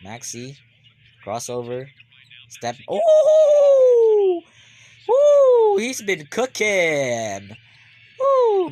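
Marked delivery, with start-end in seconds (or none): 2.9-5.8 loud very high whoop with a laugh; shrieking cheer. Real, not acted. Fan cheers Maxey's step-back shot, screaming high. 5.8-7.6 hyped praise. Real, not acted. Hyped after Maxey's shot. 8.2-8.7 loud very high whoop; cheering woo. Real, not acted. Fan cheers Maxey, whooping loud and high.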